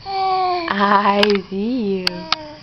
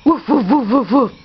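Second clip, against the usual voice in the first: put-on bouncy barking woofs for a toddler; playful woof; 4 dB louder than usual. Shown as a demonstration. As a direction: bark it out like a puppy. Showing baby what doggy says.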